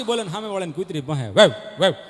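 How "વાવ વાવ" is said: mock barking; imitated dog bark. Dog barking at the singer, mock bark.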